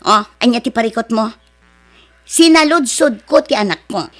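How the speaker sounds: ordinary talk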